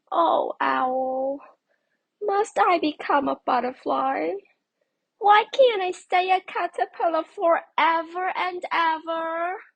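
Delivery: high, loud and pleading; small voice close to tears; pleading caterpillar. Acted. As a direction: beg the wise owl to let you stay.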